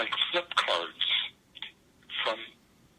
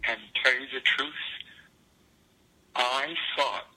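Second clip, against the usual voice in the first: normal talking voice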